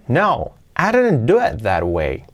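"no, I didn't do it that way" said slow, with a falling tune on "didn't"; with intonation. Shown as a demonstration. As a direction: give it the natural fall, as if denying. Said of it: "Y con entonación". (con entonación natural y descendente)

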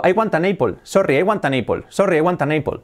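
Spanish-accented English, "apple" as "EH-pol"; each word sounded out in a Spanish accent; beginner's English with Spanish vowels; learner's Spanish accent. Shown as a demonstration. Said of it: "si estás acostumbrado a decir".

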